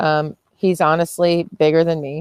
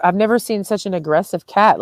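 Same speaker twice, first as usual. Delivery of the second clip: ordinary talk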